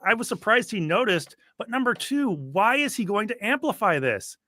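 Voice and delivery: mildly worked-up replay of bafflement; incredulous protest. Retold or imitated. Podcaster recounting a rival's needless response, incredulous. Retelling his incredulous reaction.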